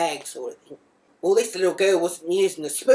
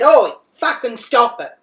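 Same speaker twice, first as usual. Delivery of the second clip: higher and far louder, slow; angry shout; 6 semitones higher, 10 dB louder than usual. Retold or imitated. Mum yelling at the mother swiping at her, loud. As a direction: bark it out to make her stop. Being hit by other mum.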